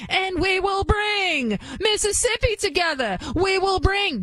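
mocking AOC with an exaggerated Southern preacher accent. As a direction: ham it up like a Southern Baptist preacher at the pulpit. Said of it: "she's become a Southern Baptist preacher".

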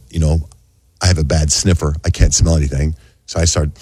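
normal talking voice

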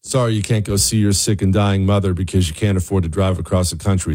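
ordinary delivery

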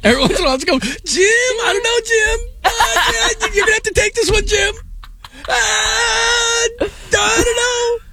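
high, whimpering mock-crying plea to Jim; whiny clueless commentator. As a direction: play a helpless announcer begging his partner for help, half-crying. Mocking commentator helplessly asking Jim.

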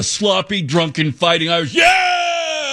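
high hammed-up rally shout; mock cheer. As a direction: cheer it like a rowdy fan. Mocking Irish mascot, shouting.

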